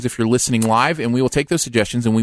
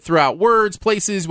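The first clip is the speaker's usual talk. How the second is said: ordinary talk, nothing marked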